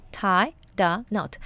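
tie, the, knot, slowly separated; word by word. Shown as a demonstration. Teacher explaining the idiom's words, slow separated tie the knot. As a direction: break tie the knot into three slow pieces.

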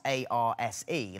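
usual speaking voice

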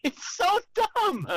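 laughing. Real, not acted. Laughing at the radio bit.

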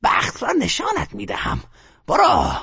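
put-on high old woman's threat; threatening grumble. A narrator's reading. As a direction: warn him off sharply.

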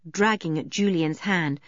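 ordinary delivery, nothing marked

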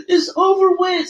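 high, hammed-up crying wail; imitating someone sobbing; mock sobbing. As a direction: wail it like a heartbroken ex. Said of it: "somebody just really crying".

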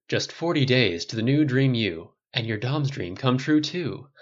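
bright, pushy infomercial pitch; over-the-top commercial announcer; ad read. Acted. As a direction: sell it like a late-night TV ad. Infomercial read within story.

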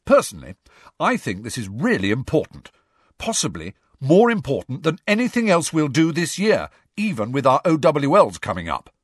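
lightly voiced self-important student, emphatic; emphatic Ernie. Student addressing the group on what matters, emphatic. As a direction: make a weighty declaration, stressing key words.

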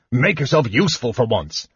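testy dwarf scolding; irritable. A narrator's reading. Dwarf ordering idle kender to help, irritable. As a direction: scold with gruff impatience. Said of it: "he said to Tass irritably".

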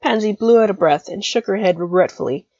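plain reading voice

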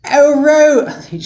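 high, loud-ish put-on southern woman's voice; Blanche's southern accent. Blanche finding Rose begging, in a high southern accent. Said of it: "She had a southern accent".

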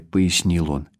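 usual speaking voice

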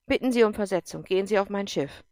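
loud, commanding officer voice; a tough general pressing a colonel hard; stern general. Acted. General challenges Erik, loud and commanding.

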